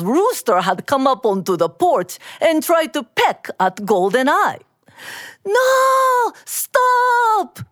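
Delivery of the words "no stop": high, desperate cry to stop; panicked scream. Acted. Mister Lee screaming at the rooster, panicked. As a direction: scream in alarm. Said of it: "Mister Lee screamed".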